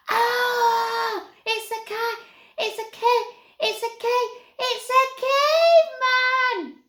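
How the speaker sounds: very high, loud, stammering terrified scream; screaming in terror. A narrator's reading. Youngest Snaggart flees from Stig, sobbing terrified scream. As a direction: shriek it as he runs, choking on the word. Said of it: "sobbing and screaming".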